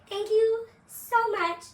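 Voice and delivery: high, slow, breathy fan gratitude; high sweet thank-you of adoring fans; grateful girls' voice. Retold or imitated. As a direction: lift it up high like starstruck young girls. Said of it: "and they're like".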